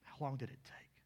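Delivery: hushed unvoiced question; preacher whispering to the room. Real, not acted. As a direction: breathe the question in suspense. Suspense at the tomb.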